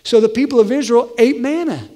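ordinary delivery

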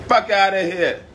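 high-pitched scornful dismissal; scornful outburst. Real, not acted.

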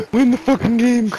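frantic high yelling; panicking gamer. Real, not acted. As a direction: scream at your teammate to win. Repeat yell.

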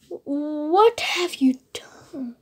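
soft unvoiced whisper, slow. Child reading Scar's line to Simba, whispered. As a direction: breathe the words like a sly secret.

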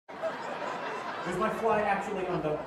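laughing. Real, not acted. Streamer finds his fly undone.

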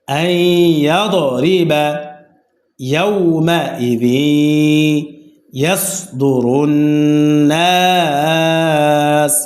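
drawn-out chanted recitation of the verse, ইয়াসদুরুন and নাস held long; slow Quran recitation. Shown as a demonstration. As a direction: chant each word slowly like Quran recitation. Teacher recites the joined verse. (ধীরে টেনে টেনে কুরআন তিলাওয়াত)

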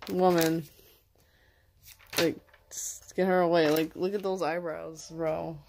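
normal talking voice

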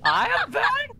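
high, loud incredulous cry; indignant, laughing disbelief; outraged disbelief. Real, not acted.

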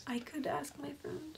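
very soft, voiceless whisper. Acted. Player offering help near a sleeping monster, whispering. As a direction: whisper the suggestion under your breath.

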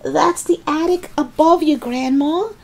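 high-pitched warning call. A narrator's reading. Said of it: "George called out".

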